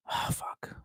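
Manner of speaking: breathless whispered curse; soft whispered swear, barely audible. Real, not acted. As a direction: drop to a whisper, muttering to yourself. Whispered aside to self.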